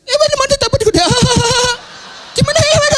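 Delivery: high, fast blubbering with laughs; mock crying. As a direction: imitate a girlfriend sobbing unintelligibly. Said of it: "Dia nangis, dia telepon gitu kan".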